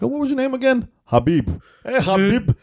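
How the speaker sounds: high tipsy flirty lady voice; drunk woman. Retold or imitated. Drunk passenger teasing the driver about his name, high tipsy voice.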